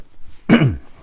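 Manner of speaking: clearing the throat; a quick throat clear. Real, not acted. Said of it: "something in my throat today".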